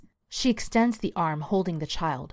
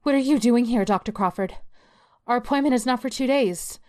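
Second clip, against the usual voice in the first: startled, higher and louder; surprised; 7 semitones higher, 4 dB louder than usual. Acted. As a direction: caught off guard by her psychologist. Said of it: "Karen, surprised by the voice".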